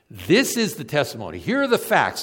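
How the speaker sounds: high, loud sermon climax; impassioned preaching, building; preaching at full force. Acted. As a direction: drive the point home from the pulpit.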